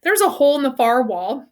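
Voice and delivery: usual speaking voice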